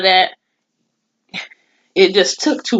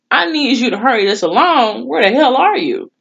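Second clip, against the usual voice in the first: higher, pushy hurry-up call; impatient demand; 6 semitones higher, 3 dB louder than usual. Retold or imitated. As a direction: press them to hurry, fed up. Imagines scolding slow ambulance.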